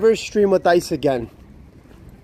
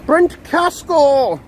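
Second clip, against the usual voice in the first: drawn-out, higher mock announcement of the name; mock call-out; 8 semitones higher, much slower than usual. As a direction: stretch the name out like a dramatic reveal. Calling out the disliked rival.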